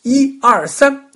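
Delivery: loud, high starting count; dad yelling a race start; shouted countdown. A narrator's reading. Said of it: "爸爸喊".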